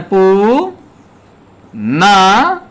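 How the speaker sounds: पु drawn long, pause, then a held न; syllable by syllable. Shown as a demonstration. Teacher showing how पुनर्जन्म is written, syllable by syllable. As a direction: sound out "पु… न" one piece at a time. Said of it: "जहां रे का उच्चारण होता है".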